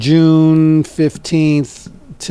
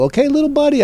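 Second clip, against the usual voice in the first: a lightly voiced friendly older guy, pitched up on the last words; chummy, jump up high on little buddy; mock folksy pal calling him little buddy; imitating Don; 12 semitones higher, faster than usual. Retelling Don's friendly words.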